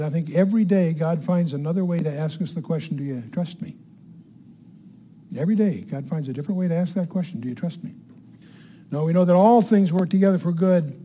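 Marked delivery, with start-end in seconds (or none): 3.0-3.8 God's gentle question. Acted. God asks us the question. 7.4-7.9 God's gentle question. Acted. God asks the question again.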